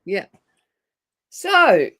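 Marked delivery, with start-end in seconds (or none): 1.3-1.9 excited. Real, not acted. Launching into the topic.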